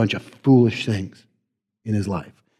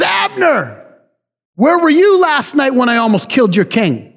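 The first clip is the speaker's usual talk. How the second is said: high-pitched call across a valley; yelling across; 10 semitones higher than usual. Acted. David calling to Saul's military man across the valley, yelling. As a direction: call out a challenge to someone far away. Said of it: "he yells".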